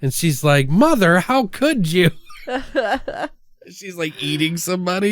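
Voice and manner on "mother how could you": high, hammed-up melodramatic reproach; mock horror. Imitating a daughter catching her cannibal mom, mock horror. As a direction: play a scandalized daughter in a bad film.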